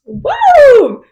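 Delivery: high, loud woo; excited whoop. Real, not acted. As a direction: let out a big high whoop. Whoop to shake off distraction.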